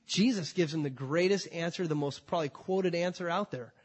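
usual speaking voice

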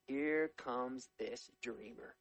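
slow, drawn-out sneer at the dreamer; scornful brothers. Acted. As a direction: stretch it out with contempt. Mocking brothers see Joseph coming.

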